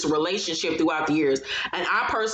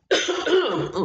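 cough; 10 semitones higher than usual. Real, not acted. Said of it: "excuse me".

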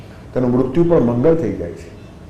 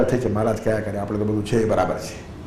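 much softer, offhand mumble of a shrugging listener; dismissive mumble; 5 semitones lower, 11 dB quieter than usual. Retold or imitated. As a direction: toss it away under your breath. Mocking careless listener after discourse.

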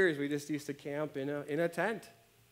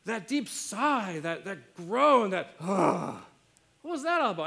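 higher, slow mock-exasperated wife; imitating wife; 5 semitones higher, 7 dB louder than usual. Wife calling out her husband's groaning, slow and higher. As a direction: drag out the complaint like a fed-up wife. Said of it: "She's like".

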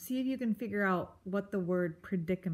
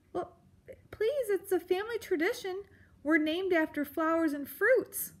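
high, hurried mouse voice; anxious pleading; 11 semitones higher than usual. Acted. Mouse defends her family naming tradition, pleading. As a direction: plead in a small, flustered voice.

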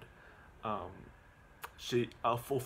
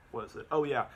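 ordinary delivery

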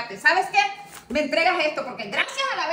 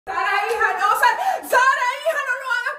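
high, loud, desperate cries; mother begging her daughter not to do it, desperate cries; desperate pleading; 7 semitones higher, 4 dB louder than usual. Acted. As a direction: beg her to stop at the top of your voice. Mother begs daughter to stop.

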